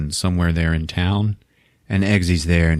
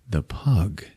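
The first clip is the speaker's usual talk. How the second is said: hushed whisper of realization; 7 dB quieter than usual. Retold or imitated. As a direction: breathe it out as it dawns on you. Realization voiced in a hush.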